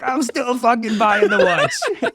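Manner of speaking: high, slow defiant laughing retort. Retold or imitated. Buyer answers friend's voice in his head, laughing defiance. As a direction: dig in and laugh it off. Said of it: "I'm like".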